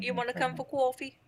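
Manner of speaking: New York accent. Shown as a demonstration. Showing the learner a New York accent. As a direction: offer coffee like a New Yorker. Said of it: "I can't do a New York accent".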